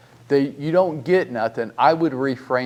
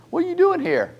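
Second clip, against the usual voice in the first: incredulous question; 4 dB louder, faster than usual. Retold or imitated. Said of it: "the first question is".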